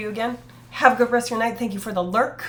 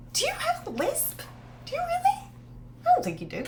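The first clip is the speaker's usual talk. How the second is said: high incredulous question. Real, not acted. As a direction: squeak it out in disbelief. Teasing a chatter about lisp.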